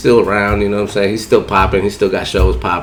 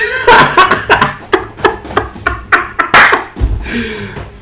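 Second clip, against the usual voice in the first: high breathless laugh fading; long airy high laugh; long wheezing laugh; 4 dB louder than usual. Real, not acted. Laughing at punchline.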